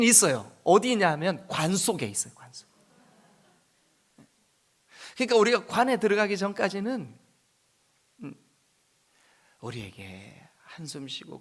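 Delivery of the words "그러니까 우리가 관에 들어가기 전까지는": ordinary delivery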